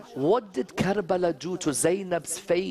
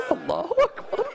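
high, quick sobbed invocation; sobbing; faster than usual. Real, not acted. Preacher overcome at Abbas's name, sobbing. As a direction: break down weeping, voice pitched high.

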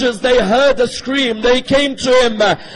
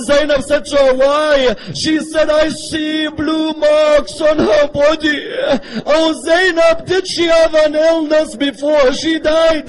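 rising mournful recitation; 4 semitones higher than usual. Acted. Climactic recitation of washing scene.

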